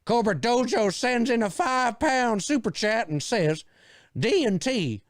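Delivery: high, hammed-up goofy announcer read; crazy voice. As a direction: ham up the super chat in a silly put-on voice. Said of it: "I'm just doing my crazy voices".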